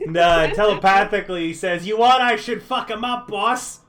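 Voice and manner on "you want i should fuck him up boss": loud, eager mob henchman offer; a mob familiar asks his boss; Boston mafia goon. Acted. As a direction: pitch it like a wiseguy offering a favour. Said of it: "suddenly from the fucking Boston Mafia".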